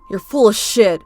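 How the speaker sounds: normal talking voice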